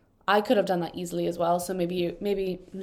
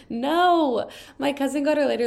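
appalled exclamation; 6 semitones higher, 6 dB louder than usual. Real, not acted. Reader hears of a leaf set on fire, appalled. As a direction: wail it out in dismay.

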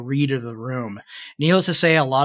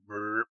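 burp; 5 semitones lower, 11 dB quieter than usual. Acted. Drunk creature burps at table.

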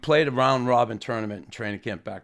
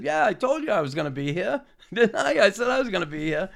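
gleeful told-you-so; 9 semitones higher, faster than usual. Retold or imitated. Gloating reply to star.

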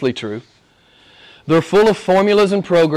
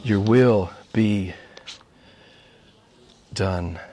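slow and softer; slow soft reverent; 7 semitones lower, 3 dB quieter, much slower than usual. Acted. Preacher repeating the prayer to congregation, tender. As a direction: let the words settle tenderly.

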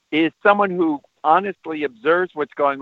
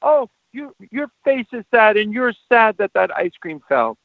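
high, exaggerated commiseration with a toddler; short, halting phrases mirroring a small child's upset; emotional toddlerese sympathy; 8 semitones higher than usual. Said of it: "What feels better is to say".

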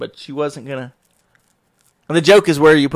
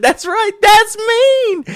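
much higher, hammed-up "that's right"; mock agreement; 5 dB louder, much slower than usual. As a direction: proudly own being mean, playfully. Dad agreeing mockingly with daughter.

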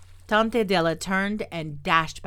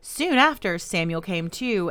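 ordinary delivery, nothing marked

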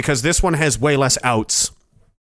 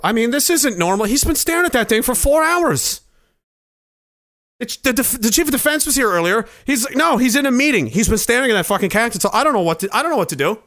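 higher, fretful aide voice; panicked aide; 6 semitones higher than usual. Acted. Aide complaining to colleagues about the president, frantic. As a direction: worry aloud to the others.

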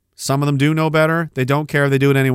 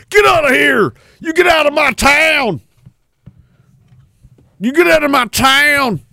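mock shouting mob; 12 semitones higher than usual. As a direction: bellow like an angry local. Imitating masked mob yelling.

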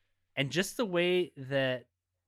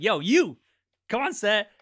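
loud, higher mock hail to a passerby; calling out; 6 semitones higher, 8 dB louder than usual. Crew grabbing a random guy outside WB, calling out. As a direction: pretend to summon someone from across the lot.

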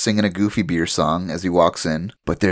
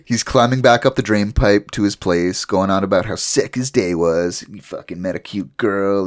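normal talking voice

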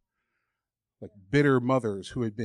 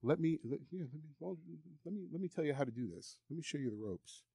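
softer, sly tempter tone, fading to near whisper; the devil's voice; 3 semitones higher, 11 dB quieter than usual. Acted. Devil whispering lies to the worshipper, quiet and sly. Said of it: "the second way he'll mess with you".

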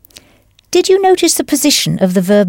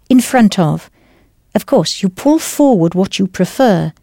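ordinary talk, nothing marked

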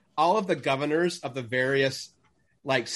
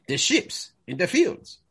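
slow, high, carefully drawn-out fake Spanish accent; mock Spanish accent; 5 semitones higher than usual. Don Diego repeating himself to the American woman, slow mock Spanish accent. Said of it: "She's like".

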